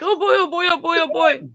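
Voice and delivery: mock doggy excitement; imitating excited dogs. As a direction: play an overjoyed dog greeting its owner. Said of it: "They still are like".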